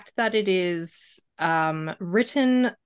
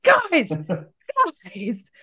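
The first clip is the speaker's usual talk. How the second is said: high-pitched, hammed-up calling out; exaggerated exasperation, pitched up; mock exasperated call; 5 semitones higher, 4 dB louder than usual. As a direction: call out in mock disbelief at clueless characters. Acted outburst at oblivious book characters.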